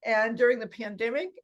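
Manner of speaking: ordinary talk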